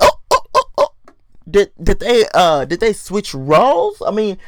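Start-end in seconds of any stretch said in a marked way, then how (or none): 0.0-0.9 high, breathless oh oh oh; shocked gasps. Real, not acted. Stunned by Jamal's outburst, high gasping ohs. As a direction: caught off guard, gasp it out.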